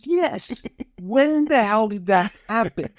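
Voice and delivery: high-pitched, drawn-out comic disbelief; mock incredulous outcry. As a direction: cry it out in exaggerated, high-voiced astonishment. Retold exclamation of dismayed agreement.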